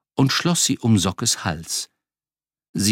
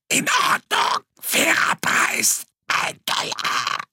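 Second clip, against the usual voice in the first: strangled croak fading to voiceless rasp; choked croak; slower than usual. Acted. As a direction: rasp it out, throat squeezed. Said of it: "krächzte er".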